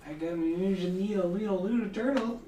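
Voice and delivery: put-on high, loud little-kid voice with a lisp; little brother lisp. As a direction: play a small boy lisping about turtles. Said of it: "exactly what he sounded like".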